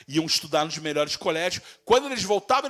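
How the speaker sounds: ordinary delivery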